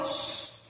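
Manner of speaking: whispered hush; hushing shh. Shown as a demonstration. Preacher hushes the church to show Selah. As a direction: breathe out a quiet shh.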